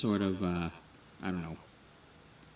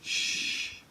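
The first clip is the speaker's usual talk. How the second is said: hushing whisper; 5 dB quieter than usual. Pretend. Hushing so they stay unnoticed.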